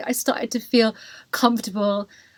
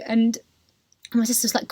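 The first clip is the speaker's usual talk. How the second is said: ordinary talk, nothing marked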